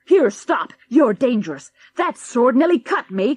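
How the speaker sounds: a man dodges a sword, panicking; sudden panic. Acted. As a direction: yelp as the blade swings by. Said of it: "cried Mister Henning in a sudden panic".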